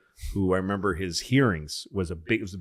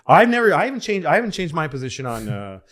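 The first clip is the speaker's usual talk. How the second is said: flustered candidate, much higher and louder, fast; flustered politician; 7 semitones higher, 6 dB louder than usual. Retold or imitated. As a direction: stammer and scramble to dodge the question. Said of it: "He goes".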